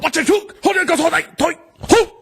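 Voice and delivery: high, hammed-up Korean accent. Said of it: "do an impression of a Korean".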